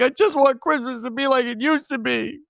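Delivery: high-pitched mock whine; imitated upset boy, very high; upset kid. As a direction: plead like an upset child, high-voiced. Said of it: "he's all upset".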